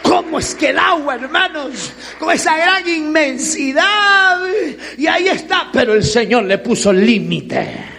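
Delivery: loud, driving preacher cadence; loud, rousing sermon delivery; fiery preaching. Acted. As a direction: preach it to the congregation full force. Preaching at full volume.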